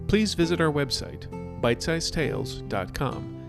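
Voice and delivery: ordinary delivery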